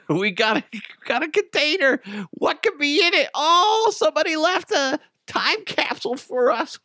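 high-pitched, giddy mock excitement; excited family. Imagined family finding time capsule.

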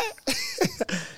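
a high squealing laugh. Real, not acted. Comic laughs at his own shocked reaction.

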